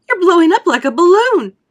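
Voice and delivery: high, loud horrified screech. A narrator's reading. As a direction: screech in panic. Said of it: "screeched Missus Beauregard".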